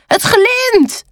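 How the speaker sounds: high crow cry of delight; high-pitched gleeful crow; excited calling out. A narrator's reading. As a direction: exclaim it high over a shiny find. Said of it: "Hij roept".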